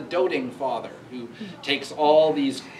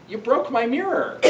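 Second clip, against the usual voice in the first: mock wounded father; 9 semitones higher than usual. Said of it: "He's like".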